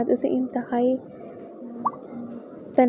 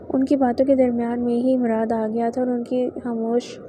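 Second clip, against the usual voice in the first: ordinary delivery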